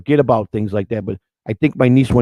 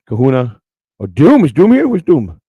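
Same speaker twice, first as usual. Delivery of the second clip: calling out; 7 dB louder than usual. Real, not acted. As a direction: shout out looking for someone. Calling out for a viewer.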